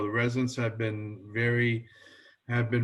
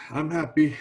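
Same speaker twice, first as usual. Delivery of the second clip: ordinary talk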